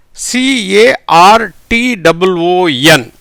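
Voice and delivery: letters spelled out one by one, very slowly; slow spelling out. Shown as a demonstration. Teacher spells the word for students, slow and deliberate. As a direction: spell it out letter by letter, taking your time. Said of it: "இது எப்படி வருதுங்கிறத பாருங்க".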